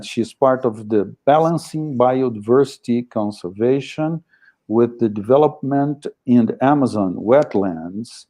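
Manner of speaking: ordinary talk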